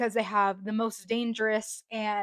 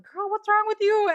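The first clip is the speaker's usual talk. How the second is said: high, loud incredulous parent voice; bewildered parents asking their crying daughter what's wrong; mock worried parent; 11 semitones higher, 7 dB louder than usual. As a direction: play the baffled parent catching her crying. Parents react to her sobbing.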